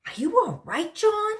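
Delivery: worried teacher. Acted. Teacher worried about fallen boy.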